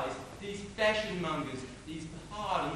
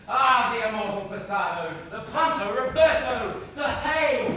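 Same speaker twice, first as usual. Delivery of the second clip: loud, grandiose mockery of fencing terms; mock fencing flourish; 4 semitones higher, 10 dB louder than usual. Mocking Tybalt's swordplay to a friend, loud flourish. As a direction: cry out each move, rising to the last.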